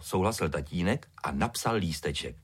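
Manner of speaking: ordinary delivery, nothing marked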